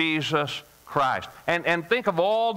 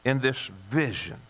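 closing prayer; 2 semitones lower than usual. Acted. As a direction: speak gently to the Lord.

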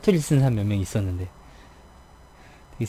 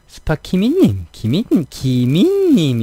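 스파키미님 with a high Busan pitch accent, slowing at the end; 4 semitones higher, slower than usual. Shown as a demonstration. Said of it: "이렇게 가더라고요, 사투리가". (부산 억양으로 높게 스파키미님)

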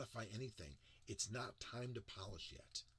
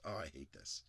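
ordinary delivery

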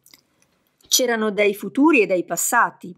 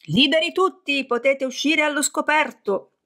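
high, ringing call to come out; joint shout; 7 semitones higher than usual. A narrator's reading. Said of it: "gridarono tutti insieme". (grido corale, alto e squillante)

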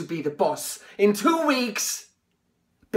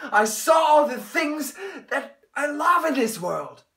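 on the edge of tears; tearful; 6 semitones higher than usual. Acted. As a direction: voice breaking, higher. Tearful memory.

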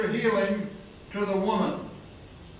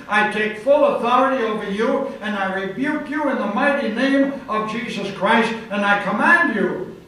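commanding rebuke; 3 semitones higher, 4 dB louder than usual. Retold or imitated. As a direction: cast the demon out with full authority. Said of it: "And I shouted back in the demon".

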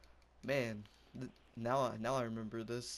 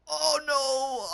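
high, long, loud scream; screamed alarm; 13 dB louder than usual. Real, not acted. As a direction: wail it at full volume. Player screams at mishap in game.

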